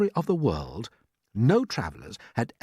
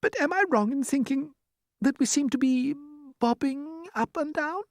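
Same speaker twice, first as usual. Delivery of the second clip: high, uneasy question; queasy ladybird. Acted. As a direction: ask timidly, pitched up. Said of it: "turning a trifle pale".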